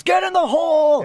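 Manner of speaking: loud, high shout imitating a rowdy spectator; imitated fan yell. Golf fan hollering after a putt, high full-voiced yell. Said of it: "someone yells".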